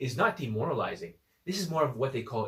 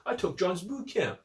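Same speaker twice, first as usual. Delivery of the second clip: higher-pitched mock quote; mocking imitation; 5 semitones higher than usual. Coach quoting a man who claims he attended, mocking. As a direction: parrot his boast mockingly.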